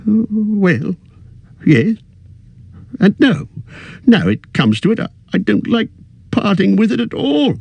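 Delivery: put-on old hobbit turning prickly; suspicious and annoyed. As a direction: bristle at the question. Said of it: "sharp with suspicion and annoyance".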